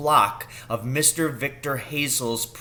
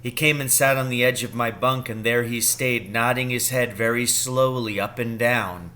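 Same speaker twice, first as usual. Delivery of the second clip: plain reading voice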